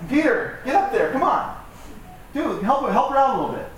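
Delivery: high-pitched, playful exasperation; mock urging. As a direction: prod a lazy friend to lend a hand. Acted Jesus rallying Peter to help.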